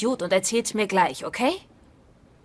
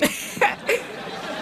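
high, breathy peal of laughter; burst of laughter; 12 semitones higher, 3 dB quieter than usual. Real, not acted. Wife laughing at her husband's panic, high laugh.

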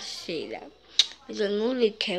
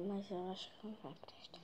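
whisper; 3 semitones lower, 18 dB quieter, faster than usual. Real, not acted. Secretive aside about phone.

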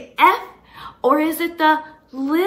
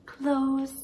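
soft close; 2 semitones lower, 9 dB quieter than usual. Shown as a demonstration. Miming fish gills for the child, hushed close. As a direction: drop the word close to a hush. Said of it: "they go open and close".

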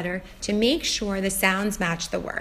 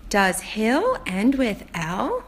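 slow questioning; much slower than usual. Shown as a demonstration. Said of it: "Now I check through the whole word".

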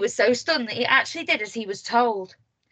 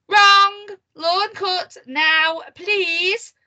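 high, loud, stretched command; imperious shout, word by word; bossy order; 8 semitones higher, 8 dB louder, much slower than usual. A narrator's reading. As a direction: bark each word slowly. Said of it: "ordered little Miss Bossy".

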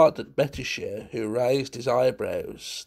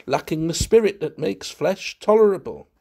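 oratorical, raised voice; relishing every word; 7 semitones higher, 4 dB louder than usual. A narrator's reading. Valentine needling his host about human flesh, with relish. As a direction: declaim it as a self-pleased wit. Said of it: "said Valentine with relish".

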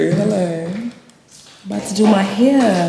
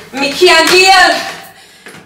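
higher, very slow Jamaican patois, stretching every word; Jamaican accent, drawn out; 12 semitones higher, 9 dB louder, much slower than usual. Acted. To her viewers, worn out from Nigeria, a slow Jamaican accent. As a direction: moan it slowly in a Jamaican accent. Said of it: "that's why I didn't do my Jamaican accent".

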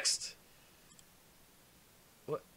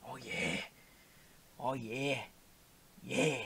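mock sultry; 3 semitones lower, much slower than usual. Mock-sultry crooning while colouring.

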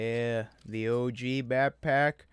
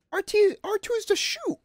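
much higher, louder exclamation; excited realization; 5 dB louder than usual. Real, not acted. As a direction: blurt out "R two is to shoot!" in surprise. Gamer realizes button mapping.

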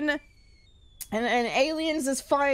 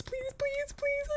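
pleading; 10 semitones higher, 8 dB quieter, slower than usual. Real, not acted. Begging in scary game.

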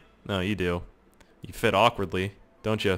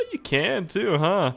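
high, slow surprised question; suddenly finding the stairs movable; 8 semitones higher, much slower than usual. Real, not acted. Talking to the stairs, surprised they move.

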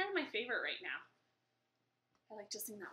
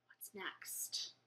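whispered aside; hushed curiosity; 4 semitones lower, 11 dB quieter than usual. Real, not acted. Reader turns to the next verse, hushed curiosity. Turning page between verses.

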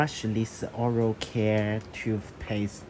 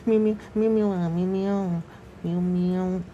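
loud, high playful meows; imitated meowing; 5 semitones higher, 4 dB louder, faster than usual. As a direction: meow back at the cat. Owner meowing at cat.

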